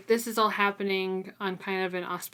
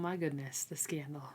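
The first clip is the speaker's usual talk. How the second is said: hushed, breathless whisper of fake shock; mock scandalized whisper; 4 semitones lower, 9 dB quieter than usual. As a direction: whisper it like a gossiping aunt. Mock outrage at a kiss.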